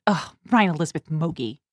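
imitating a weary parent, low and soft; understated, fed-up parental scolding; exasperated full-name scolding. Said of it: "that's when I get my full name".

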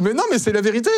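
laughing protest. Real, not acted. Man insisting to a doubting friend he's telling the truth, laughing protest. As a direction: protest your honesty while laughing. Proteste en riant qu'il dit vrai.

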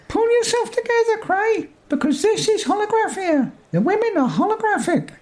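high, squeaky little man. Acted. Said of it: "Oh, here we go".

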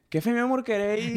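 high-pitched mock Maracaibo lilt; imitated Maracucho accent. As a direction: tease partner's borrowed Zulia accent. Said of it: "me decís".

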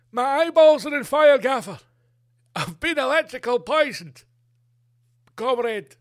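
high, loud anguished Russian victim; hammed-up wail from a poisoned Russian soldier. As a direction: cry out like a zapped comrade. Victim voiced, comrade.

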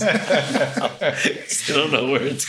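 slow words through laughter; laughing helplessly, slowly; talking slowly through laughter. Real, not acted.

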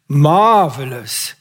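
slow, stretched-out lazy drawl. A narrator's reading. Fritz boasts to Chris about Sally, slow drawl. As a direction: let the word stretch out lazily. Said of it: "he drawled".

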